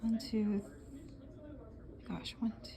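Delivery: whispered counting under breath; whispering. Real, not acted. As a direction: count softly to yourself.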